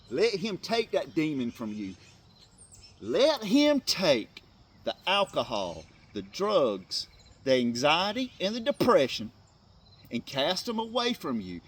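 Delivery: urging viewers to give up their demons; preaching at full force. Acted. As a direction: drive the call home like a pulpit preacher. Preaching builds on deliverance.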